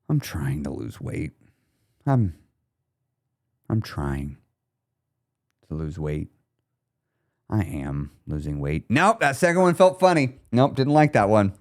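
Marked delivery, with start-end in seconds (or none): 0.1-6.3 low, soft and halting, acted sadness; sad, depressed. Shown as a demonstration. Coach acting in a sad headspace, depressed. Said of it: "get in a sad headspace". 7.5-8.9 acted low, quiet despondency; sad, depressed. Shown as a demonstration. Coach testing the phrase in a sad voice, depressed. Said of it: "get in a sad headspace".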